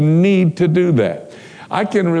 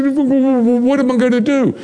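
nervous stammer; 10 semitones higher than usual. Retold or imitated. Hitchhiker nervous about Bible.

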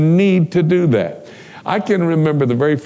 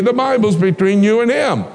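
ordinary talk, nothing marked

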